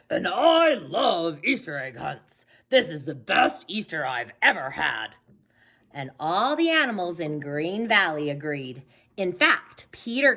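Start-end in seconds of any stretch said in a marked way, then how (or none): 0.1-5.1 wolf voice. Acted. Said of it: "wolf voice".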